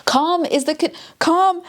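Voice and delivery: high, gushing repeat of calm; overjoyed fan squeal; giddy delight. Real, not acted. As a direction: swoon over the words, voice jumping up. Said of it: "I can't handle it, it's too good".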